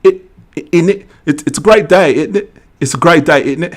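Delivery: high, loud, hammed-up British; British accent. Host proving a point to a viewer, mock British accent. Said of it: "if I had a British accent".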